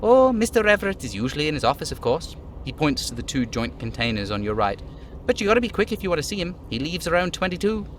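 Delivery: little dockhand hurrying to help; hurried dockhand. Acted. Voicing the little guy.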